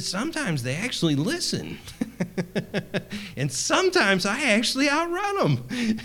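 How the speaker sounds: high, laughing, delighted imitation of a brother; gleeful retelling. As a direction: play his cheerful joy at being beaten half the time. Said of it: "that's exactly the way he acted about it".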